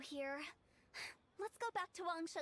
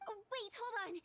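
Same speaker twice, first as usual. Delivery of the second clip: higher, quick plea to stop them; hasty plea; 5 semitones higher than usual. Acted. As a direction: call them back before they leave. Pleads as she is dismissed.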